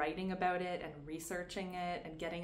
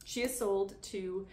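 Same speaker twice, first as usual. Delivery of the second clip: ordinary talk, nothing marked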